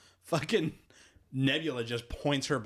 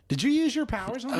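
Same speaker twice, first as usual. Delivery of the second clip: much higher, louder demand; accusing; 13 semitones higher, 4 dB louder than usual. Retold or imitated. Quill questioning Mantis, much higher and accusing. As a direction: confront her sharply. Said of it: "he's like".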